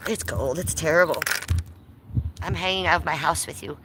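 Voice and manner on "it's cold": usual speaking voice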